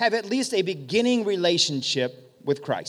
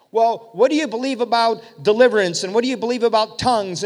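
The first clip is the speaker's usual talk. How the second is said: louder, higher interrogating voice; pressing objector; 4 semitones higher, 6 dB louder than usual. Acted. Objector quizzes healed man on deliverance, insistent. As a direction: quiz him insistently, pitched up and loud.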